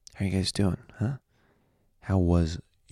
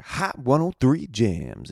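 high, slow sung-out station ID; radio station jingle; 7 semitones higher, 4 dB louder, much slower than usual. Retold or imitated. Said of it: "So they're like".

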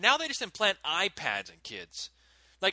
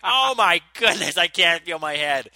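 collapsed in the snow after dad's blow, loud mock wail; mock scream; 9 dB louder than usual. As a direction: howl it like you're hurt but milking it.

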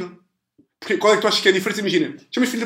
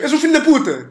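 mock insult; 9 semitones higher, 5 dB louder than usual. Acted insult quote.

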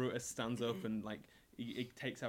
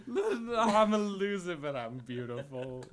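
high, loud, giggling protest; laughing; 6 semitones higher, 10 dB louder, slower than usual. Real, not acted. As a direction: laugh through a mock-proud defense. Laughing back at the tease.

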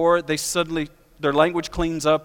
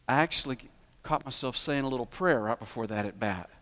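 softer, leaning in to confess; a low-key, softer-spoken admission; retelling a fan's sheepish confession, softer; quiet confession; 7 semitones lower, 7 dB quieter than usual. Retold or imitated. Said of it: "leaned over quietly and confessed".

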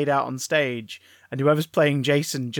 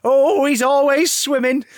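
shrill camp pantomime dame; 9 semitones higher, 7 dB louder than usual. Acted. Mrs Voorhees as panto dame.

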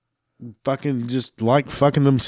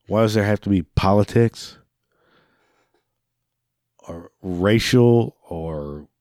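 ordinary talk, nothing marked

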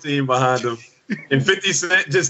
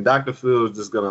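normal talking voice

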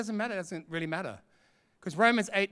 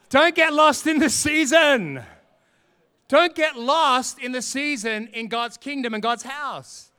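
slow, loud, higher exhortation; emphatic preaching; 4 semitones higher, 7 dB louder, slower than usual. Acted. Preacher urging the congregation, slow and loud. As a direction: drive the warning home to the church.

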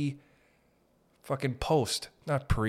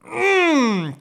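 high exasperated hum; 7 dB louder than usual. Real, not acted.

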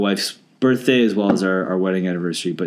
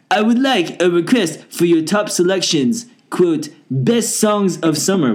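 fake French accent; 6 semitones higher than usual. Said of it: "please read my emails with the accent".

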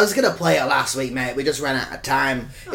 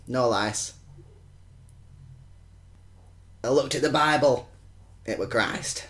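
slow, soft, pause-laden poem reading; reciting a home-made poem to Derek; poem recital; 6 semitones lower, 7 dB quieter than usual. Acted. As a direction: read it with long solemn pauses. Reading his poem aloud.

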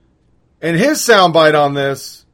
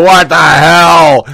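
loud, slow, stunned outburst; shocked what the hell; 3 semitones higher, 12 dB louder, much slower than usual. Retold or imitated. Mother turning to her husband in shock, loud appalled outcry. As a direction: blurt it out at full volume, drawn out in disbelief. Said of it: "you look at your husband and go".

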